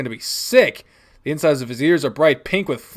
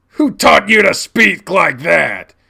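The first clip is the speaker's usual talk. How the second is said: loud, slow, raised scolding; outraged demand; 5 semitones higher, 10 dB louder, slower than usual. Acted. Dad outraged at child's swearing, angry demand. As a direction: demand it, hammering each word.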